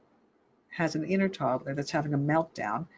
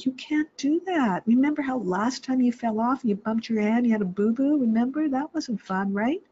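higher, sing-song parent warning; reminding parent voice; 8 semitones higher, 3 dB louder than usual. Retold or imitated. Mom warning her climbing toddler, higher sing-song. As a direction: coax the climbing kid like a worried mom. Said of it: "you're saying".

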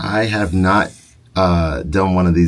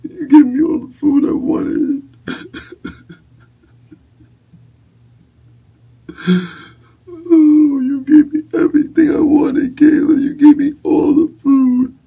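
very high sobbing falsetto; hammed-up tears of joy; mock happy crying; 5 dB louder than usual. Dad fake-cries with joy.

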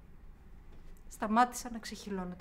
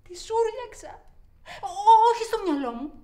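higher, louder frantic telling; shaken, raised voice; agitated cry; 10 semitones higher, 12 dB louder than usual. Acted. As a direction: blurt it out shaken. Shouted, after the scream.

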